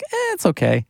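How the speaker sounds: high, shrugging mock voice; lukewarm assistant. Assistant giving a lukewarm verdict, high shrugging voice. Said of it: "just kinda was like".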